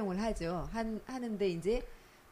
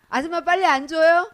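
louder, higher mock complaint to the waitress; impatient customer; 7 semitones higher, 11 dB louder than usual. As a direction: act out a customer who can't wait. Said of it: "십 분을 못 기다려요". (조급하게 재촉하는 손님 흉내)

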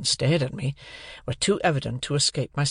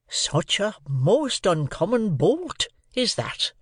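slow, higher, swooping rustic voice; amazed Joe; 7 semitones higher, slower than usual. A narrator's reading. Joe marvelling at Pip's gulped bread, in slow amazement. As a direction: draw it out in earnest wonder.

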